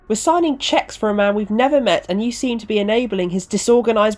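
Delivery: high-pitched, aggrieved rant; annoyed complaint. Acted. Organiser complaining to Ronnie about the delay, annoyed.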